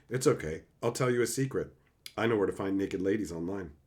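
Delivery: quick, quiet read-out of a text message. Shown as a demonstration. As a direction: rattle it off softly, like reading your screen. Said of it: "I'm texting somebody right now saying".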